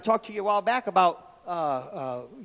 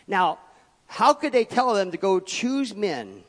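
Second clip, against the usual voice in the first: loud, drawn-out pulpit preaching; preaching at full force; 2 semitones higher, 8 dB louder, slower than usual. Acted. Pastor preaching hard to his church, at full force. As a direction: thunder it out over the congregation.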